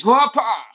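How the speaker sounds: high, loud mock martial-arts shout; hammed-up kung fu strike cry; karate yell. Mock elbowing enemies in face.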